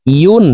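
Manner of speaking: slow ইউন with a clear y glide; correct ya. Shown as a demonstration. Teacher showing the correct reading, y-glide on ইউন. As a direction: begin ইউন with y from mid-tongue. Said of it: "ইয়ার উচ্চারণ পড়তে হবে এইভাবে".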